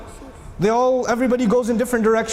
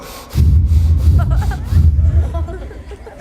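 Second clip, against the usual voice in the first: loud laugh; 9 semitones higher, 6 dB louder than usual. Real, not acted. Loud laugh mid-sermon.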